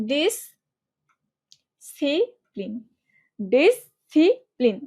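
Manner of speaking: "ডিসিপ্লিন" broken slowly into dis, ci, plin; syllable by syllable. Shown as a demonstration. Teacher showing students how to remember the spelling, syllable by syllable. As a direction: draw out "ডিসিপ্লিন" with gaps between the syllables. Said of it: "বানানটা কিভাবে মনে রাখবা".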